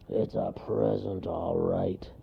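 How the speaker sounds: slow, grudging grumble; gruff, unimpressed bear; bear's grunt. A narrator's reading. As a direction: grunt it sourly. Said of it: "grunted the bear".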